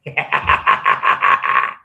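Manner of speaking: a low breathy burst of laughter; breathy low cackle. Real, not acted. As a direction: let out a deep wheezing laugh. Laughing at his own face.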